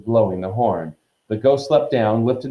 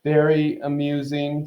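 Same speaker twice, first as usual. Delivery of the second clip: slow, higher, icy sarcasm; darkly; 6 semitones higher than usual. A narrator's reading. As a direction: draw it out with displeasure. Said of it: "Nearly Headless Nick darkly".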